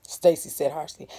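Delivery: plain reading voice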